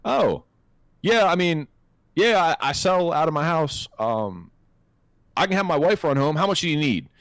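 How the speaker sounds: lightly mimicked seller talking to buyers on the phone; voicing seller. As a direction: play a laid-back guy selling from home.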